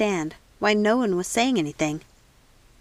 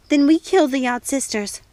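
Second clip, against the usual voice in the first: sudden vow; 5 semitones higher, 5 dB louder than usual. A narrator's reading. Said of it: "surprising herself".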